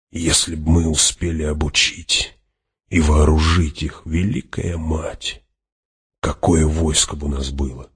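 subdued, softening musing with pauses; bitter regret. A narrator's reading. Hawat dreams of the army they lacked, bitterly regretful. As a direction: think it aloud with understated longing. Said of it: "горько подумал Хават".

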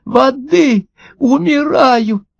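high, drawn-out fish voice; high-pitched little creature fading away; dying plea. A narrator's reading. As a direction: plead slowly in a tiny high voice, as if dying. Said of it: "прошептала рыбка".

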